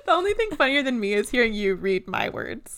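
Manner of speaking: real laughing delivery; talking through laughter. Real, not acted. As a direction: say it while still cracking up. Co-host amused by own notes.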